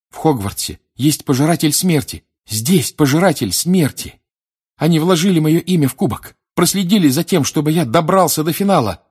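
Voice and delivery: high, loud, frantic warning; urgent alarm. Acted. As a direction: raise the alarm breathlessly. Boy urgently warns of traitor. (тревожно, высоко и громко)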